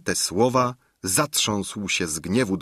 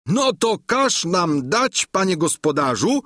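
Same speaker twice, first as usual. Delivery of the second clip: Sancho spelling out his order; 10 semitones higher, 4 dB louder than usual. A narrator's reading.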